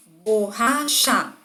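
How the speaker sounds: BORRACHA in three stretched beats, "bor - ra - cha"; dividido em sílabas. Shown as a demonstration. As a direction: say borracha syllable by syllable. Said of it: "uma palavra é maior que a outra". (borracha separada em sílabas, bor... ra... cha)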